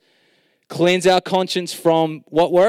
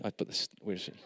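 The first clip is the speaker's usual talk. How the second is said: usual speaking voice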